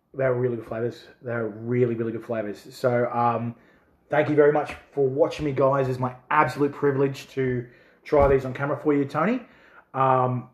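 usual speaking voice

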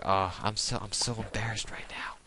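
whispering to yourself, mortified among friends; embarrassed whisper. Retold or imitated. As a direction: hide in your own whisper. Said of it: "you're like".

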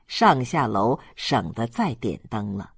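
ordinary delivery, nothing marked

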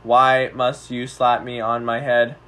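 slow, low robotic alien voice; old speech synthesizer. Acted. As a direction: speak like a stiff alien robot. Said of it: "blind alien and human roleplay".

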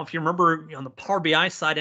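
usual speaking voice

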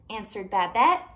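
usual speaking voice

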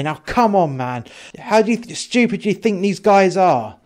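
indignant, worked-up bar owner; agitated protest. Acted. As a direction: protest your innocence in a heated rush. Dave protests to threatening cop.

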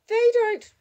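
put-on very high, loud little-girl cry; outraged child. Six-year-old whose pillow was thrown away, outraged child. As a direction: protest at full pitch as a small child.

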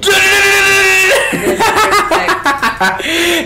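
high, shrieking laughter; screaming laughter at the broken car; hysterical laugh. Real, not acted. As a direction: crack up completely.

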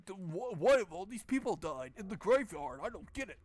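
high, clueless cartoon alien voice. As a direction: play the hero as a baffled alien. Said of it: "He's like a cartoon alien".

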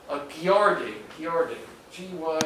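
"Gyarding" as gyarding, G sliding into y; local pronunciation. Shown as a demonstration. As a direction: say "Gyarding" with a y-glide after the G, like gyar-ding. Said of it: "as if it were spelled G Y A R I N".

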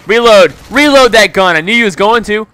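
high, loud gloating shout; real excited yelling; triumphant yell. Real, not acted. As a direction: shout it in his face. Yelling at enemy reloading.

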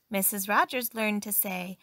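usual speaking voice

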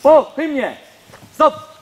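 high, loud parade command; drill sergeant. Real, not acted. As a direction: bark the command. Commands after the anthem.

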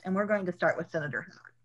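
normal talking voice

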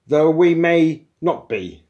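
slow, high, loud qualification; loud interjection. Acted. Intruder waving a warning finger at programmers, loud. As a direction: cut in loudly and pedantically.

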